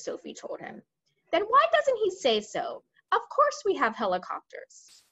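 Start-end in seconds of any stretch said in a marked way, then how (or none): 1.3-5.0 exasperated. Acted. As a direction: snap back in exasperation, starting high. Air Force chief exasperated.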